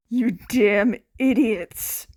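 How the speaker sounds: slow, gruff mock scold; rescued teen scolding his rescuers, slow and gruff; harsh words. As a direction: bark it out, secretly glad. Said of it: "Despite the harsh words".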